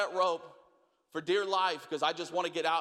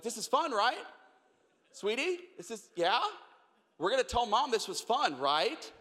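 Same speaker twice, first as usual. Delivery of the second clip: coaxing parent; 5 semitones higher than usual. Retold or imitated. Parent coaxing scared kid.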